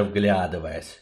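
ordinary delivery, nothing marked

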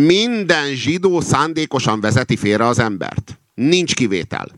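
high, slow, emphatic pronouncement; resolute declaration. A narrator's reading. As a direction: state it categorically, pitched high. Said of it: "jelentette ki határozottan a róka". (határozott, magas hangú kinyilatkoztatás)

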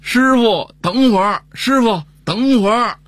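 high-pitched call after a departing bus; drawn-out, high shout to make someone wait. Retold or imitated. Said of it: "就站在站台上喊".

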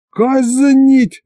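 very high, loud outraged yell; furious shout. A narrator's reading. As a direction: bellow the sentence. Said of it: "заорал я".

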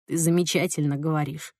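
sincere. Acted. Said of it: "убеждённо сказал Бенисио".

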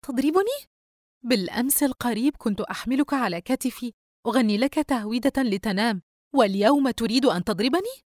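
high, incredulous, wounded reproach; astonished and aggrieved. A narrator's reading. Sister who once sang him lullabies, astonished and aggrieved. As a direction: protest in hurt disbelief. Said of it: "بخليط من العجب والقهر".

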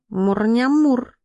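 high, slow cat noises; talking cat purr. A narrator's reading. Cat perhaps praising a pastry, purring slowly. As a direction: draw out a purring, munching meow. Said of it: "что хотел сказать кот".

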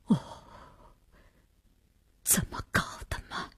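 whispered, stunned exclamation; low-voiced astonishment. A narrator's reading. Mei hears of the death, hushed astonishment. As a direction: draw a breath and murmur in shock, very softly. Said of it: "低声惊讶了一句".

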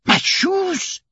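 very high cry without extra volume; astonished exclamation. A narrator's reading. Servant recognises his shoes, astonished outcry. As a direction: yelp it high in disbelief. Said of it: "shouted out Passepartout, who was surprised".

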